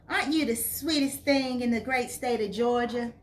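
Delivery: high, lilting Southern Belle; Southern Belle accent. A narrator's reading. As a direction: put on your best Southern Belle for a rhetorical question. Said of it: "in her best Southern Belle accent".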